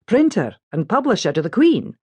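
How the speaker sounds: a gentle Scottish lilt on a polite remark; Scottish accent. A narrator's reading. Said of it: "By his accent, he's a Scot".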